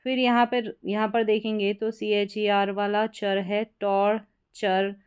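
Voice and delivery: ordinary delivery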